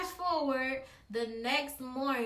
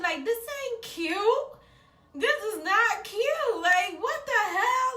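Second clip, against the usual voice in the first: higher, laughing complaint; complaining in pain; 8 semitones higher, 4 dB louder than usual. Retold or imitated. Girl telling herself the pain isn't cute, higher laughing whine. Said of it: "in my head, I'm like".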